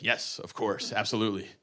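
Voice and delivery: very soft, low agreement; mock solemn. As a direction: agree gravely and quietly, deadpan. Feigned solemn agreement about hospital visit.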